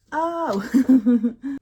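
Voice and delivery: a loud, high surprised "Oh!"; delighted cry. Real, not acted. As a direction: gasp it out in sudden delight. Delighted by the scent.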